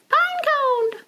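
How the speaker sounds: high excited cry; cheery character shout. Acted. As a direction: call it out gleefully. Character exclaims.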